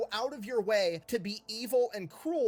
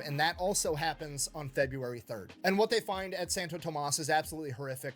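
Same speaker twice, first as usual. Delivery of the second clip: normal talking voice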